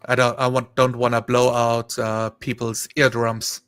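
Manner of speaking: ordinary delivery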